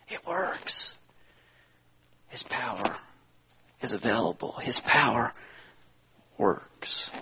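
soft whispered awe; a preacher whispering his point to the congregation; hushed whisper. Acted. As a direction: drop to a reverent whisper. Hushed after the story.

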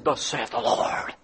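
hushed, breathy mock pronouncement; lightly mocking pulpit voice; mock preacher voice. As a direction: imitate a preacher intoning scripture. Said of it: "your preacher's constantly saying".

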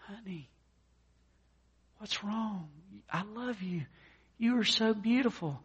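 soft, high-pitched coo of a worried husband; acted tender concern. Husband finds his wife crying after her bad day, mock tender.

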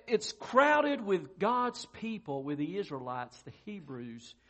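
ordinary talk, nothing marked

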